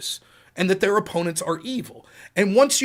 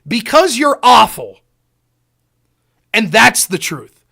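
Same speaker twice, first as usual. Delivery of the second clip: contempt; 3 semitones higher, 9 dB louder, slower than usual. Retold or imitated. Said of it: "looks straight in his face and goes".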